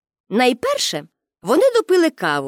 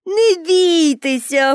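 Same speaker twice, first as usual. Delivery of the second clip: drawn-out, higher-pitched call; 5 semitones higher, slower than usual. A narrator's reading. Pippi to policemen edging along the roof, calling out. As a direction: call slowly across the roof. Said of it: "гукнула їм Пепі".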